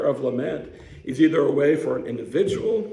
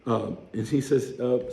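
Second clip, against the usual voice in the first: ordinary delivery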